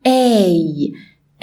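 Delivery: A said with a dropped jaw, long open ay; American A, jaw low; letter A. Shown as a demonstration. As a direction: drop the jaw and say A. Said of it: "هو اسمه".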